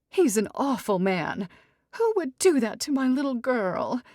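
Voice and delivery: haughty mother fussing over daughter; dramatic society mother. Acted. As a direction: sweep in like a grand matriarch. Mrs Evers indignant.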